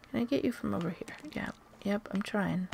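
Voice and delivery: whispering. Real, not acted. Whispering while stalking enemy.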